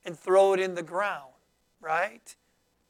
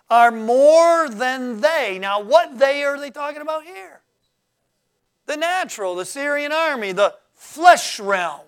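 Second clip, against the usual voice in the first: fiery preaching; 3 semitones higher, 6 dB louder than usual. Acted. Preacher builds to the church, loud rousing sermon delivery. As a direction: preach it out to the congregation. Preacher rising to full force.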